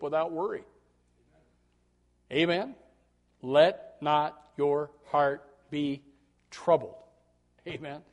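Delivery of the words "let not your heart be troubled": slow preached scripture. Acted. Quoting Jesus, slow and loud.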